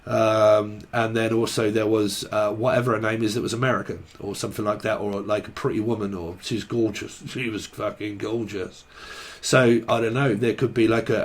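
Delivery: ordinary talk, nothing marked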